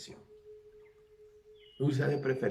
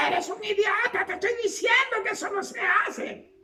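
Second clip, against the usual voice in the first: shrill mock shout, far higher; insulting yelling mother; 3 dB louder than usual. A mother insulting her son, in a shrill mock shout. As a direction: hurl the insult like a screaming mother. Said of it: "la madre usa hasta adjetivos, le dice".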